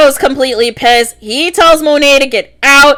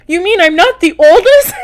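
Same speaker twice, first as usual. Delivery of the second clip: mock outrage, pitched up; retold pissed-off disbelief; 6 semitones higher than usual. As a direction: imitate an offended son in a higher, incredulous voice. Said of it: "Kane's all pissed and he's like".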